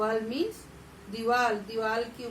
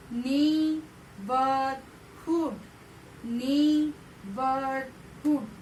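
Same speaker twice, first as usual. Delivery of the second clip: slow drill; much slower than usual. Shown as a demonstration. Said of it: "repeat करिए".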